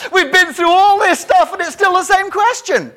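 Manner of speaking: real laughter in the words; laughing. Real, not acted. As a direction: talk through loud, high laughter. Laughing at repeated question.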